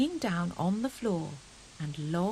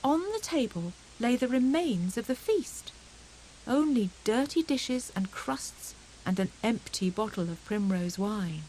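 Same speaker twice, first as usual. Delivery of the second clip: usual speaking voice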